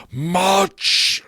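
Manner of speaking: high, loud ghost voice; ghostly Marley. Acted. Marley's ghost answering Scrooge, high ghostly cry. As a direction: boom it out like a ghost.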